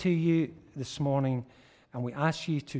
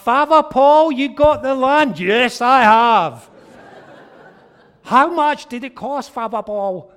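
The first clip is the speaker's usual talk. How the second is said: very high, loud squealing staff voice; excited restaurant staff; 11 semitones higher, 16 dB louder than usual. Retold or imitated. Restaurant staff voiced greeting him.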